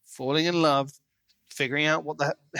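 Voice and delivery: ordinary talk, nothing marked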